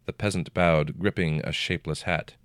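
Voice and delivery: usual speaking voice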